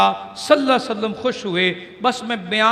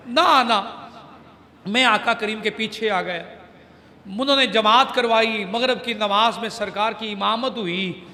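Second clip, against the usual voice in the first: usual speaking voice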